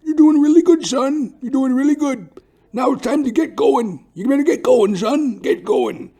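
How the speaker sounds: put-on very high, loud old-man urging; the Colonel's voice. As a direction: push him on like a hearty old Colonel. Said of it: "he goes".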